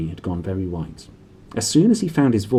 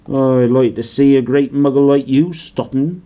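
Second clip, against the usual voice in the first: Hagrid voice; 4 semitones higher, 6 dB louder than usual. A narrator's reading.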